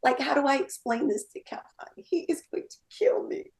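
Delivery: fast, whispered worried thoughts; whispered nervous inner voice; panicked whisper. Retold or imitated. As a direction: hiss your inner worry.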